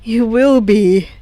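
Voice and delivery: low, slow, calm promise; father reassuring the frightened girl. Acted. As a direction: reassure her gently. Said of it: "the man confirmed".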